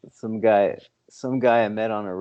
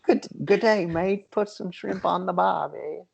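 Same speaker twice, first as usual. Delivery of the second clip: high, hammed-up Aussie accent; mock Australian; 10 semitones higher than usual. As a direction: do a cartoon Australian bloke. Joking about foreign gamer stereotypes.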